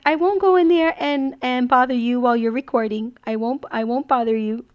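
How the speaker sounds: high, loud child imitation; daughter's promise. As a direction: promise like an eager little girl. Mom voicing daughter's promise.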